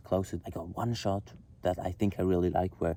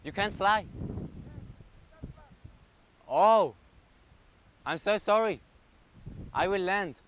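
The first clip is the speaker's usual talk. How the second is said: high-pitched, startled apology; apologetic calling out; 10 semitones higher than usual. Real, not acted. Drone pilot stopped by police, high apologetic reply. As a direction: call back high, startled and sorry.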